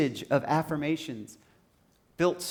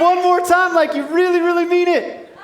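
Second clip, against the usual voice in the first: high, loud, insistent crowd call; real full-voiced rousing yell; rallying shout; 10 semitones higher, 8 dB louder than usual. Real, not acted. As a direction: demand one last roar from the crowd.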